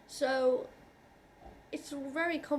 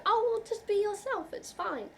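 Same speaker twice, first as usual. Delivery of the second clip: high, breezy mock-cheerful parroting; airy high mock of dismissive friends; mocking people's advice; 9 semitones higher, 4 dB louder than usual. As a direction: mimic the glib advice in a sing-song. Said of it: "when people say".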